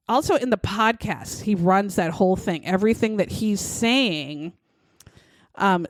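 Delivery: ordinary talk, nothing marked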